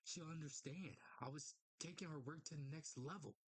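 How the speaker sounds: low, measured dramatic read; comic narration. Acted. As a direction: narrate gravely, leaving pauses between phrases. Reads comic narration aloud.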